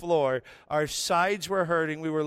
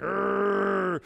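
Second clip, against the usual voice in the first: long, steady held growl; drawn-out mock dog growl; dog growl imitation; 3 semitones higher than usual. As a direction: growl like an old dog at a wall. Imitates old dog growling when called.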